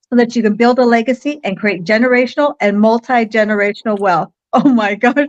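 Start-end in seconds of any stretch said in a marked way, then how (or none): none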